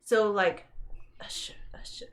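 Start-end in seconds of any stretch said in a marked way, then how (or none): 1.2-2.1 very soft, voiceless whisper; whispered aside. Real, not acted. Storyteller echoing the job title they both held, whispering.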